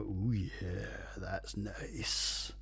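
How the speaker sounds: put-on sleazy old man purr; dirty old man voice. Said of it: "In a dirty old man voice".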